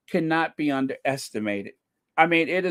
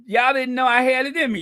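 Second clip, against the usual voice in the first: playful boast; 10 semitones higher, 5 dB louder, faster than usual. Pretend.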